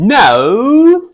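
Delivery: high, loud exaggerated British no; British accent. Shown as a demonstration. Man asked to demonstrate by his partner, mock British accent. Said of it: "say no in a British accent".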